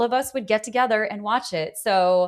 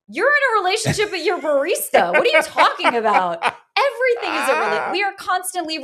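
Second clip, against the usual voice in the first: pitched way up and louder, incredulous; incredulous outburst; 10 semitones higher, 4 dB louder than usual. Real, not acted. Host scolding listeners afraid of commitment, incredulous and high. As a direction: throw it out in disbelief, high and loud. Mocking people scared of the word.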